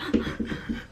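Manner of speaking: sharp unvoiced gasp for air; breathless gasp; gasp demo. Shown as a demonstration. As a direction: suck in air like after sobbing. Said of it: "gasping for air".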